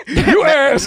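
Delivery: laughing it out; amused laughing protest. Real, not acted. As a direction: say it through a real laugh. Laughing after a grim story.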